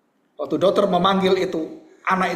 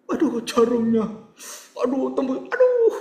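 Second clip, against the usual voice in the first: anak takut jarum; 8 semitones higher than usual. Acted. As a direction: play a child dreading the needle. Acting child dreading the needle. (rengekan anak takut jarum)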